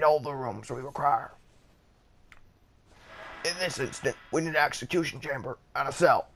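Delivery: strained attempt at a cartoon character voice; Rick. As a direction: read the instructions as an impersonated cartoon character. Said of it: "put on my Rick voice".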